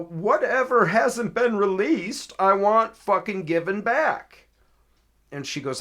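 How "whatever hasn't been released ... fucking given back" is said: loud, high, slow and emphatic; angry demand. Retold or imitated. Caller tells officer what he wants released, angry. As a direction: hammer out the demand word by word.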